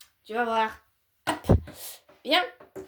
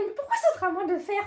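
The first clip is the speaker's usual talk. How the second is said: put-on whiny child; 10 semitones higher, much faster than usual. Whiny kid voice in argument.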